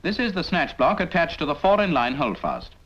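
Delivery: ordinary talk, nothing marked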